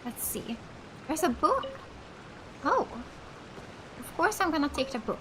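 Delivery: ordinary talk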